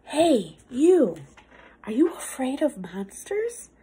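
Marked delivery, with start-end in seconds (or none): none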